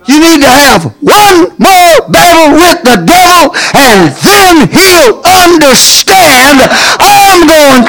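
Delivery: high shouted testimony, near tears; shouting praise, close to crying. Real, not acted. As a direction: preach it out high and breaking. Testimony rising to shouted cry.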